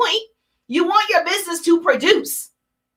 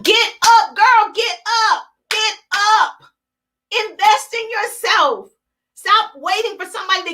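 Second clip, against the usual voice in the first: higher-pitched, punchy urging; urgent wake-up call; 5 semitones higher than usual. Retold or imitated. Telling people sleeping on their talents to rise, urgent high push. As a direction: rouse a sleeper, pitched up and insistent. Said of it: "And I'm like".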